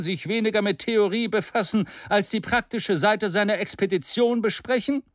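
a hint of a stern stranger; strong serious voice. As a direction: pull the speaker back to facts. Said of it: "von einer starken und ernsten Stimme".

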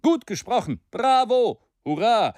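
a mock crowd cheer. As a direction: cheer the speaker on. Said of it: "riefen einstimmig die Versammlung".

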